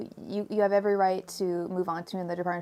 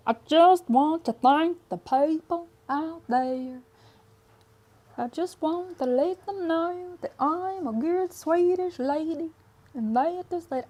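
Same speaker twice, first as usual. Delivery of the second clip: mock a terrible Swedish accent, sing-song and high; Trixie's bad Swedish accent; 7 semitones higher than usual. Trixie thanking her fans in a silly fake Swedish accent. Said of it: "really dumb Swedish accent that is just, like, so bad".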